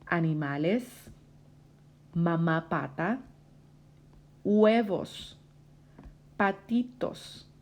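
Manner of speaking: slow, drawn-out words with pauses between; repeat-after-me reading. Shown as a demonstration. Teacher has student repeat story words, slow model reading. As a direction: model each word for a learner to copy. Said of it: "Repite conmigo".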